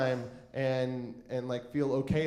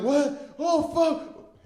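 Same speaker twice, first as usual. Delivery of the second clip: high-pitched bewildered reaction; acted bewilderment, pitched way up; startled waking; 11 semitones higher, 3 dB louder, much slower than usual. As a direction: come to with a high, confused start. Waking up passed out, confused.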